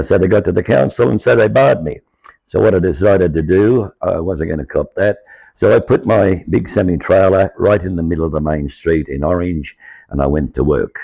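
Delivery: ordinary talk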